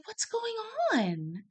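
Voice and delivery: gentle doctor. Retold or imitated. Doctor arrives on the ward.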